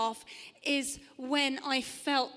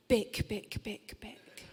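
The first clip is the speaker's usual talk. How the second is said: hushed, unvoiced whisper of the poem's last words; 4 semitones lower, 6 dB quieter, slower than usual. Comic poet reciting to the audience, whispered. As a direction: breathe the words like a secret.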